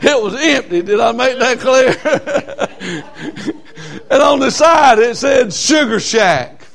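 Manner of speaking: high, loud, cackling over the name; laughing. Real, not acted. Preacher laughing with the church about the Sugar Shack. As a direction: laugh it out, tickled.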